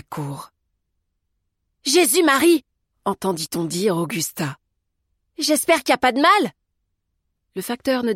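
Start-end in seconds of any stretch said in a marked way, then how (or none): 1.8-2.7 high, loud cry of dismay; startled, aghast outburst; shocked exclamation. A narrator's reading. Maid reacts to the crash. 5.4-6.5 high, loud worried call; anxious rising exclamation; alarmed concern. A narrator's reading. Maid worries after the crash.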